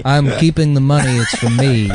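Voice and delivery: lightly voiced tough-guy actor; understated hard-man refusal; Charles Bronson. Host playing Bronson refusing the heiress's family, light impression. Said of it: "I was doing the Charles Bronson voice".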